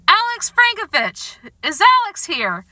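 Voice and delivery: high, projected call for a winner; announcer calling out. A narrator's reading. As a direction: call the name across a hall. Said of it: "the announcer called again".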